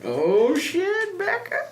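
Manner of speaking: rising, high drawn-out mock alarm; drawn-out teasing oh shit. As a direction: tease with a long climbing "ooh". Mock ominous teasing of Becca.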